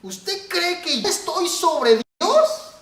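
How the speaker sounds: high, loud, drawn-out disbelief; incredulous challenge. Retold or imitated. Brother asking pastor if he outranks God, incredulous.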